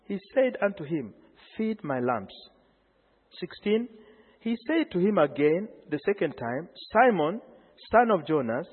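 soft, low Bible reading; scripture reading. Acted. Pastor reading Jesus and Peter aloud to the congregation, soft and low. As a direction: read the old words gently and low. Reads scripture dialogue softly.

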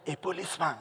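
very soft mock child voice; hushed reply. Child telling a caller who is beside him, hushed. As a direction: drop right down, nearly whispering. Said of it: "Again, he whispered".

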